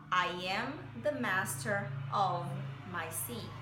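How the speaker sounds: each word said slowly and apart; slow and spaced. Shown as a demonstration. Teacher reads the song line slowly, word by word. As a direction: say it word by word, unhurried. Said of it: "pausadamente seria".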